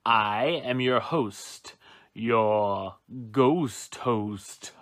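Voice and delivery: deep, slow spooky ghost host; low creepy haunted mansion host. Acted.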